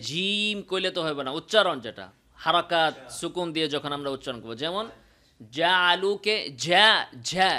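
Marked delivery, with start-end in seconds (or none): 5.6-7.5 ঝা said slowly with no breath leaking; slow model ঝা; correct জিম. Shown as a demonstration. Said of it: "জালু এভাবে বলতে হবে". (ধীরে বাতাস ছাড়া জিম)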